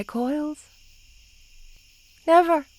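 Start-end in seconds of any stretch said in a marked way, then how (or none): 2.3-2.7 louder and higher, a possessive snap; a sudden jealous outburst; fierce never. Acted. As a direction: spit out the vow fiercely. Possessive vow to beloved.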